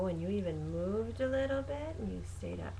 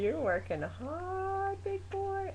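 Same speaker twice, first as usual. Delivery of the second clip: higher sing-song praise; 10 semitones higher than usual. Real, not acted. Praising baby.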